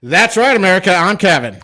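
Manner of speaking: loud, high hype man intro; hyped host shout. Acted. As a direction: pump up the crowd.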